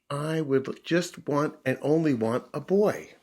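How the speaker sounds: slow, high, every word pressed; insistent puppy. Acted.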